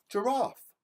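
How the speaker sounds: ordinary delivery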